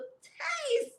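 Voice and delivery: tiny, high, soft squeak; teasing high voice. Pretend. Host offers viewers a little taste, teasing high soft voice. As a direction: lift it way up and soften it, teasingly.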